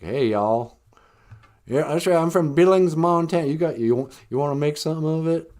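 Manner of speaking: cowboy spoiling for trouble in a Southern drawl; thick Southern drawl. Acted. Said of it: "with a thick Southern drawl".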